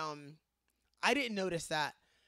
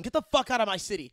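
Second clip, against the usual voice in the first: ordinary delivery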